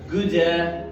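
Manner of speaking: جودا said slowly as goo-da, d joined to a; linked d-a. Shown as a demonstration. Teacher breaks down good afternoon: slow syllables. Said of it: "بربطها في ال D".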